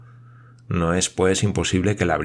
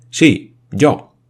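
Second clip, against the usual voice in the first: emphatic claim; 7 semitones higher, 8 dB louder than usual. Acted. Paganel claims he knows.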